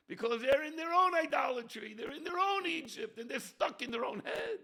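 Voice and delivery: loud, high preacher's shout; fiery preaching. Acted. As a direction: hammer the point home. Preaching at full force.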